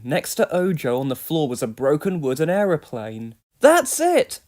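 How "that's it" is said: high, loud angry outburst; angry cub. A narrator's reading. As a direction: snap it out, fed up. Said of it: "Ojo was angry".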